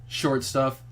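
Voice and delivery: ordinary talk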